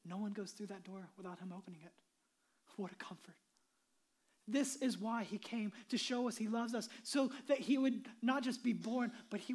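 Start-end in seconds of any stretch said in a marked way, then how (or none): 2.8-3.3 whispered softly in awe; awed whisper. Acted. Preacher whispering in awe to the church. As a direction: let it fall to a whisper.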